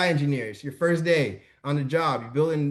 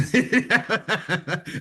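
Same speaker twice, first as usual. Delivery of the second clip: high, delighted laugh bursting out; real amused laugh; burst of laughter; 7 semitones higher than usual. Real, not acted. Host amused by colleague's synopsis.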